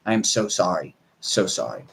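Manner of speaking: quiet mock apology from the dog; sorry dog voice. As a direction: voice a guilty dog apologizing softly.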